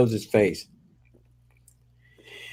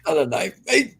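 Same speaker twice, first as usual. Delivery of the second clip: a hint of an Australian accent, rising on this; a loose, hammed-up Aussie quote of Crocodile Dundee; 6 semitones higher than usual. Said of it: "You sounded just like him, not".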